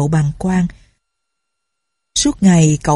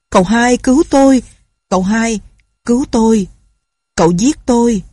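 desperate cry for help; 4 semitones higher than usual. A narrator's reading. Prisoner to cậu Hai, desperate high cry for help. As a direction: cry out for rescue from the cell.

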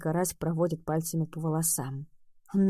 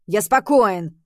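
angry shout; 2 semitones higher, 9 dB louder, slower than usual. Acted. Rem shouts he is calm.

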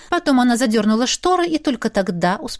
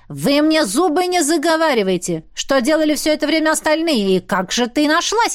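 raised-pitch, impatient demand; irritated interrogation; 6 semitones higher than usual. A narrator's reading. Old host grills the evasive guests, irritated. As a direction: press them crossly for answers.

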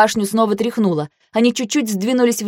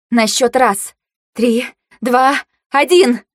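higher, spaced, rising countdown; 4 semitones higher, 3 dB louder, slower than usual. A narrator's reading. Heroine counting down to the throw, commanding. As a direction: build to a loud final count.

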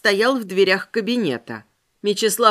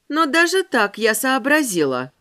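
ordinary delivery, nothing marked

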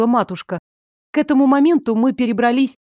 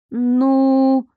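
higher, long drawn-out filler; hesitant; 4 semitones higher than usual. A narrator's reading. Daughter stalls before confessing to mother, hesitant. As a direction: stall on one held word.

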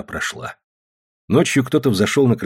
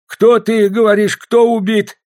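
loud, high, drawn-out demand; alarmed calling out; 12 semitones higher, 6 dB louder, slower than usual. A narrator's reading. Grandfather shouts from the back room on hearing of a killing, calling out. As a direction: call it out from another room, high-pitched.

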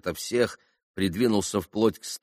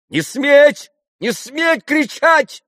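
very high, loud commanding shout; 11 dB louder, much slower than usual. A narrator's reading. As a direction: silence them at full voice. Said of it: "крикнула Грушенька". (громкий повелительный крик)

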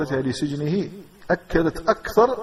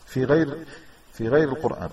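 normal talking voice

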